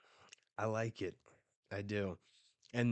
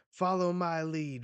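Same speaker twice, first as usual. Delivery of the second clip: rallying command; 8 semitones higher, 7 dB louder than usual. Acted. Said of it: "And Rox says".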